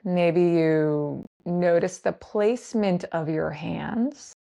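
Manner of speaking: slow grounding-practice voice with long pauses; guided meditation. Acted. Teacher guiding listeners to land in the body, guided meditation. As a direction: lead them gently into noticing, unhurried and spaced out.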